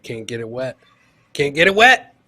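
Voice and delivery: ordinary talk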